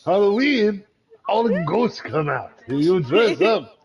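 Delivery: high, mock Japanese accent; hammed-up Japanese accent. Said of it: "my Japanese accent".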